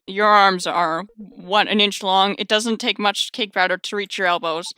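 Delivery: ordinary talk, nothing marked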